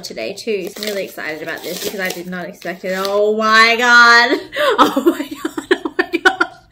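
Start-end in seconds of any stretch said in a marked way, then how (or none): 2.9-6.6 gobsmacked. Real, not acted. Overwhelmed by the surprise gift.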